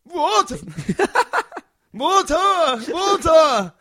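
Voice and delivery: high, loud strangled yelling; old man gasping at the door, shouting high and loud; desperate shout. Retold or imitated. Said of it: "And Lionel went".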